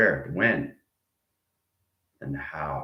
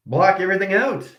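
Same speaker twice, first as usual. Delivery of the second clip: slow inner guidance; 9 semitones higher, slower than usual. Shown as a demonstration. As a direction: draw them inward, slowly. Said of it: "listening to your own voice".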